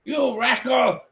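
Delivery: high, loud angry roar. Acted. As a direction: bellow at the thieving kender. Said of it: "he roared".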